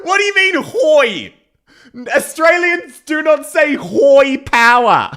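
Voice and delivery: high, loud protest; indignant outburst. Real, not acted. Australian streamer objects to the bad accent, loudly indignant. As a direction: exclaim in exasperated disbelief, drawing it out.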